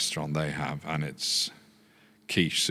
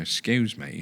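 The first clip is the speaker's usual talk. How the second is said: higher-pitched Yorkshire woman; Yorkshire accent; 6 semitones higher, slower than usual. Retold or imitated. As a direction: imitate an elderly Yorkshire lady. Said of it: "change your accent when she was speaking".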